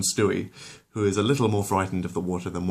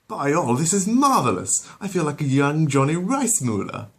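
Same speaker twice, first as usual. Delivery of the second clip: put-on higher, louder gleeful baby; delighted Stewie voice; 8 semitones higher, 5 dB louder than usual. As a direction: revel in the splashing.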